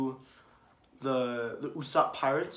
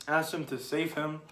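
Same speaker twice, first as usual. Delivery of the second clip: usual speaking voice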